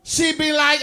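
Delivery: ordinary talk, nothing marked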